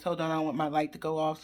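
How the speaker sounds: ordinary talk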